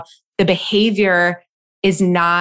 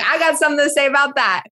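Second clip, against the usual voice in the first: fired-up, quick and higher; defiant; 7 semitones higher, much faster than usual. Retold or imitated. Host on camera about crying, defiant and quick. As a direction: announce it like you're ready to fight.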